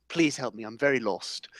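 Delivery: low, quiet, lost chef; Gordon Ramsay. Acted. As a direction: plead softly in a deep put-on voice. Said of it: "Gordon's identity crisis".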